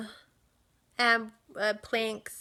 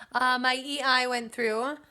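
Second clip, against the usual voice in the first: usual speaking voice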